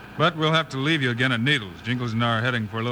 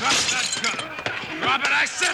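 commanding shout; 12 semitones higher, slower than usual. Acted. Ordering a gunman to drop weapon.

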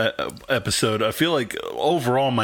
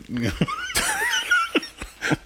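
squeaky high-pitched laugh; high giggle. Real, not acted. Laughing at own bit.

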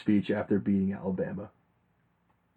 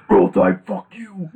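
higher, louder Roll Tide, then fuck you dropping off; Coach O shout; 8 semitones higher, 5 dB louder, slower than usual. Retold or imitated. Host repeats Coach O's taunt, higher and loud at first. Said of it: "Dude, like".

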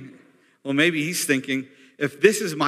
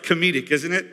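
ordinary talk

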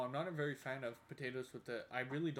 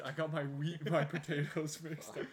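louder, laughing mid-line at the slip; laughing at herself; 3 semitones higher, 7 dB louder than usual. Real, not acted. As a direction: laugh through the mix-up. Laughing at own mistake.

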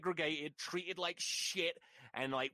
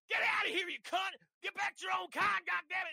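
enraged father; 13 semitones higher than usual. Acted. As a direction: shout the trespasser off your land. Father chases girl off yard.

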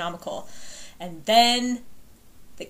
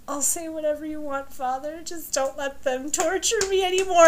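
pleading son; 8 semitones higher than usual. Retold or imitated. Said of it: "And he's like".

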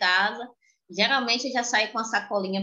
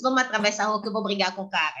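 fast hypothetical; faster than usual. Retold or imitated. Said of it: "ela não disse".

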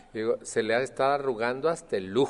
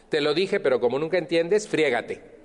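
higher, louder exasperated scolding; scolding family member; 5 semitones higher, 3 dB louder than usual. Retold or imitated. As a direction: snap at your own sister in contempt. Acting harsh tone with family. (regaño áspero y despectivo, más alto y fuerte)

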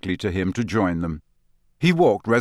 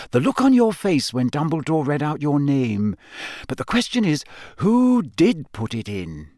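higher earnest schoolgirl, worried; a clever girl reasoning it out; Hermione voice; 6 semitones higher than usual. A narrator's reading.

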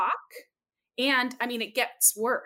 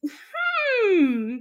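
high, louder drawn-out pondering hmm; thoughtful hmm; 11 semitones higher, 7 dB louder than usual. Real, not acted. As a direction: muse suspiciously. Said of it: "I'm currently doing, like, a thinker pose".

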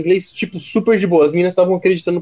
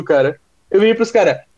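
usual speaking voice

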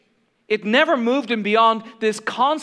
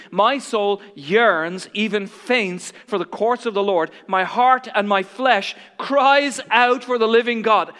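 fervent psalm reading; 2 semitones higher, 5 dB louder, slower than usual. Acted. Psalm quoted with fervour.

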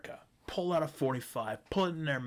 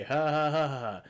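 mock laugh; 3 semitones higher, 6 dB louder than usual. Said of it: "cracks jokes".

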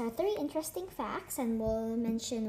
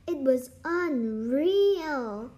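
slow, louder, stretched out; awed; 6 dB louder, much slower than usual. A narrator's reading. Akemi awed after the race, excited. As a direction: savor it in awe. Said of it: "said Akemi".

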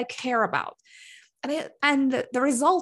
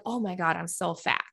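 self-critical thought; 7 semitones lower, 5 dB quieter than usual. Retold or imitated. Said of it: "my thought about it would be like".

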